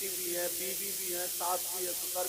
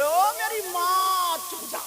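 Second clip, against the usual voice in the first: pleading husband; 10 semitones higher, 11 dB louder, slower than usual. Retold or imitated. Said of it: "ہاتھ جوڑ کے بولے".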